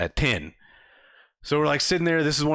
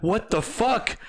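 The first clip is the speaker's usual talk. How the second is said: high, incredulous complaint; mock the customer, pitched up; exasperated mockery; 7 semitones higher than usual. Worker mocking the customer's hundred-dollar bill, high and incredulous.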